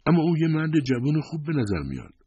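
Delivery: high-pitched astonished protest; taken aback, pitch lifted right up; amazed. A narrator's reading. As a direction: object in sheer amazement, voice held high. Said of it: "شگفت زده به او خیره شد".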